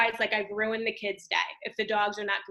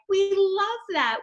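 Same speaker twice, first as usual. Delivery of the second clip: high slow thrilled cheer; high eager children's voice; excited kids; 9 semitones higher, 5 dB louder, slower than usual. Retold or imitated. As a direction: kids delighted by the book. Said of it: "And they were like".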